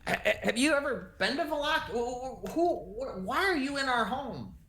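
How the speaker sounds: high, stammering homeowner; indignant and rattled, pitched high; flustered older woman. Acted. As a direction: trip over the questions in a high voice. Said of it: "she goes".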